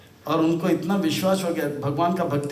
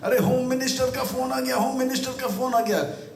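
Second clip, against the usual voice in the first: higher-pitched acted panic; mock fluster, pitched up; tense, flustered pilot; 7 semitones higher than usual. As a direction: play a pilot flustered by an important call. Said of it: "तो इसको आ गया tension".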